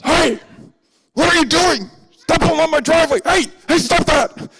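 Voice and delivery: high, loud angry yelling; furious neighbor. Acted. Neighbor dad catches boy pulling up driveway, furious yell. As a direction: bellow like an enraged dad guarding his property. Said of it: "he is furious".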